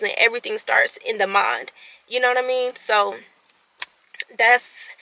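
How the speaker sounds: normal talking voice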